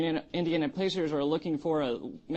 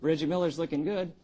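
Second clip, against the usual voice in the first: normal talking voice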